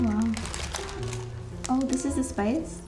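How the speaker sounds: ordinary talk, nothing marked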